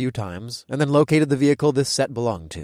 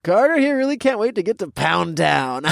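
frat bro voice; 7 semitones higher than usual. A narrator's reading. Toby voice.

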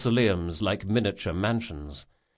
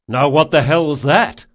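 loud, raised-pitch shouted question; yell; 5 semitones higher, 9 dB louder than usual. A narrator's reading. Jean asking the girl about the tumult, yell. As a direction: call out the question to someone down the boat. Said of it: "Jean yelled to the girl".

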